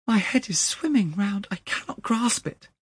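very high, distraught voice; reeling shock. A narrator's reading. Young man staggers at the clerk's news, distraught. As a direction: sound overwhelmed and dazed.

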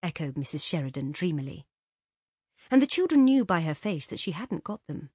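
ordinary delivery

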